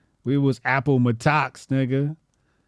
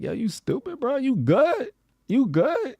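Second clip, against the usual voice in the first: much higher, teasing disbelief; mock incredulous brother; 12 semitones higher than usual. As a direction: ask if he's okay like he's lost it. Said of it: "He like".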